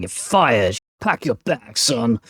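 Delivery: a mock American boss accent, lightly done. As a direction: fire them in a hammed-up American accent. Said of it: "That's my best American accent".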